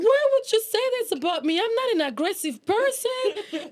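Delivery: high, offended retelling of her own protest, slowing at the end; hurt protest. Retold or imitated.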